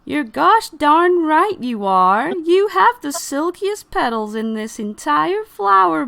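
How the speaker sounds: high, drawn-out, emphatic character voice; hammed-up high flower voice; gushing flower sidekick. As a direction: back up your friend with over-the-top flattery. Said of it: "and it goes".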